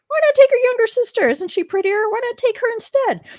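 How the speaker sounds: high, mocking father voice, loud to start. Father offering his younger daughter, high and mocking. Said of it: "So her father said".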